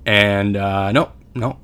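ordinary talk, nothing marked

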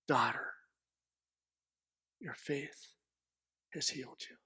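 low, very soft and spaced out; tender reassurance. Acted. Jesus speaks to the fearful woman, quiet and tender. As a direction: soothe her quietly, pausing between phrases.

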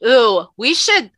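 usual speaking voice

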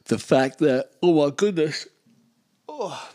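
words stretched through a real yawn. Real, not acted. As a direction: talk through a surprise yawn. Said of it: "Never yawn on the microphone".

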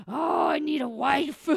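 loud, high, drawn-out mock lament; exasperated wail. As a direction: wail it as an overwhelmed dog owner. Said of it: "I'm just like".

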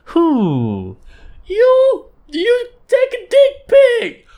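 very high, slow squeaky voice; squeaky cartoon voice. A cartoon sidekick answering the host, very high and squeaky.

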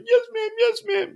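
put-on very high, squeaky child voice; mimicking a frightened child's quick compliance; imitated obedient little boy. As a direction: pipe up like a scolded kid. Scolded boy answers his mother.

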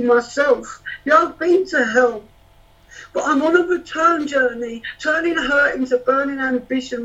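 rhythmic poem recital; spoken word poetry. Acted.

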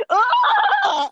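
shrill laughing wail; high anguished cry. Real, not acted. Reacts to the ending.

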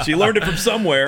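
loud, high, through laughter; real laughing. Real, not acted. Teasing about the dog's garbage habit, laughing loudly.

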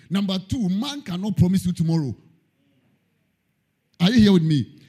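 ordinary talk, nothing marked

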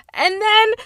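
high, loud, frantic outrage; worked-up rant. Real, not acted. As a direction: rant like your team just got robbed. Ranting about the goal replay.

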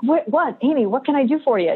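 high, eager and quick, a boss thrilled to help; fast, high, keen to help. Retold or imitated. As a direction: chirp it up like an overeager boss. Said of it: "He's like".

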